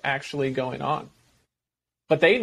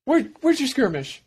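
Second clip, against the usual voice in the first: high, sing-song pet talk; playful voice for a puppy; mock dog-owner voice; 8 semitones higher than usual. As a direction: tease the dog to fetch. Talking to a dog.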